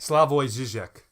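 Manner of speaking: slow, loud SLAH-voy ZHIH-zhek; looked-up pronunciation. Shown as a demonstration. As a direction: say Zizek with two zh sounds, slowly and firmly. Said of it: "look up a pronunciation of his name".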